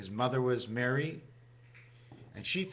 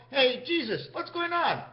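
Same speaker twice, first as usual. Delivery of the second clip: much higher, bright casual hello to a buddy; cheery greeting; 10 semitones higher than usual. Acted. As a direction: call out high like greeting a pal. Greeting Jesus in play.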